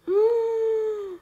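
high crying gasp in the dark; fearful gasp. Acted. Seed character gasps as everything goes dark.

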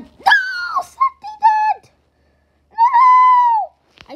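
high, loud despairing cry of no; anguished cry. Real, not acted. Gamer watching his idol get caught, crying out high and loud. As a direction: wail as your hero goes down. Sentinet gets caught in game.

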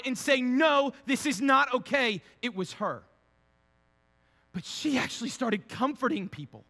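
loud, high preaching voice, hammering each point; full-force preaching. Acted. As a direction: preach it out to the whole room.

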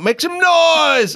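high, slow hype shout; hype man call. Retold or imitated. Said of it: "He just kinda walked out".

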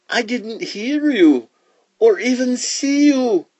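very high, slow iguana voice; explaining iguana. Acted. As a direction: explain innocently in a high creature voice. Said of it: "said the iguana".

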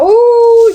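long, loud, high "ooh"; drawn-out high exclamation; startled "ooh". Real, not acted. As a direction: let out a loud rising whoop of surprise. Startled by what appears on screen.

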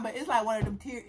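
normal talking voice

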